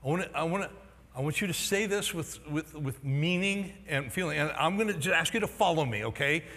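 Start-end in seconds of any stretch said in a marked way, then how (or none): none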